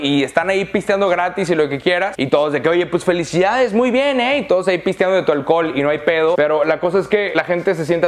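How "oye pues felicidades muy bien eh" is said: high, sing-song fake praise; hammed-up flattering friend; mock congratulations. Hangers-on congratulating the successful. (felicitación falsa y burlona, aguda)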